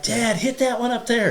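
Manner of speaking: high-pitched imitation of excited children; imitated kid's eager call; excited kids. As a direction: pitch it way up like a thrilled kid egging Dad on. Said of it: "they start screaming and cheering".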